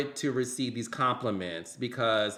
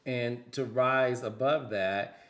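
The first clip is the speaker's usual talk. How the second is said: ordinary delivery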